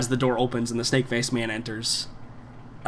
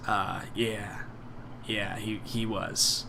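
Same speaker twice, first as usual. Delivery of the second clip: forced smile; 2 semitones lower, 8 dB quieter, slower than usual. Acted. Snake-faced man answering about someone who was one of the best, forced smile. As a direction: agree quietly and haltingly through a forced smile. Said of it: "a forced smile and says".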